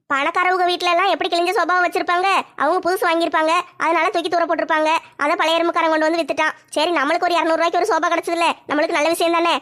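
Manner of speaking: ordinary delivery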